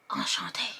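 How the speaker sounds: whispery mock French accent; hushed, put-on French accent; attempted French accent. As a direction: softly try on a French accent. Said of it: "How do they talk?".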